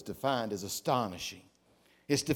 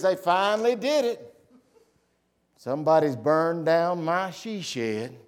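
louder mock outrage, lightly acted; indignant Cheryl; 3 semitones higher, 5 dB louder than usual. Wife reports the burned she shed to her agent, mildly hammed-up indignation. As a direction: retell her complaint with raised voice. Retelling Cheryl's upset phone complaint.

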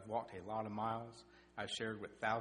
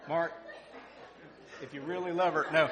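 fired-up preaching; 2 semitones higher, 7 dB louder than usual. Acted. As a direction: preach it loud to the whole room. Preaching at full force.

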